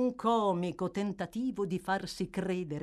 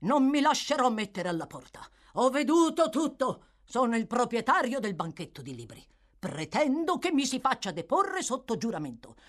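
higher, forceful insistence; determined protest; 6 semitones higher, 5 dB louder than usual. Acted. Bookseller demands to testify before Fang, protesting forcefully. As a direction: demand firmly to be heard. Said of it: "Aveva modi decisi".

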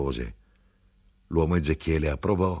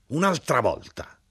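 exasperated shout; 8 semitones higher than usual. A narrator's reading. The grinder finds a ticket on his wheel, exasperated cry. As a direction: cry out at yet another fine. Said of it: "E l'arrotino urlò".

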